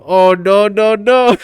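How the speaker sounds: imitating a viral "oh no"; meme imitation. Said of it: "I forgot all about that meme".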